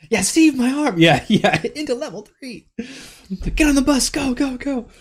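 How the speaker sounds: high, loud hype yell; hyped shouting. Pretend.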